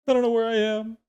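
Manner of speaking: high, loud, bewildered animal voice; confused camel voice. Acted. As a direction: squeak it out like a lost camel.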